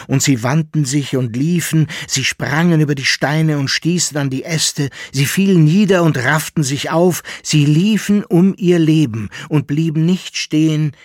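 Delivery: loud, rushing, breathless telling; urgent building narration. A narrator's reading. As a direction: build the panic of the chase. Narrating a panicked flight.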